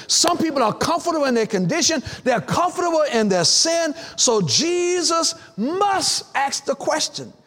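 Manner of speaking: building preacher's cadence, rising in pitch; insistent pulpit exhortation, climbing steadily; impassioned preaching. Real, not acted. Preaching builds in repeated phrases.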